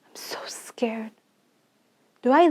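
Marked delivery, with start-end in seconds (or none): 0.1-1.1 breathless frightened whisper; barely voiced, afraid; scared whisper. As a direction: whisper it so no one hears. Frightened child alone in new country.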